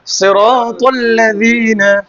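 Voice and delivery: সিরাতাল্লাযিনা stretched very slowly, syllable by syllable; slow model recitation. Shown as a demonstration. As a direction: draw out সিরাতাল্লাযিনা for the child to repeat. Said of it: "teacher models for student". (খুব ধীরে টেনে শেখানো)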